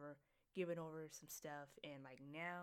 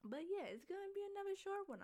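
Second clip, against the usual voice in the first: 1 high squeal; faster than usual. Real, not acted. Squeals about short podcast.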